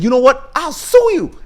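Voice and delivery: high-pitched mock yell; Trump yelling. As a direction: pitch it way up into a cartoon threat. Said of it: "as he's yelling at him".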